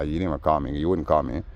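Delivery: ordinary delivery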